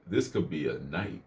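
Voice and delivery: low, hushed aside to himself; inner man. Retold or imitated. Inner man tempting him in the elevator, low and quiet. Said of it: "that inner man was in me was like".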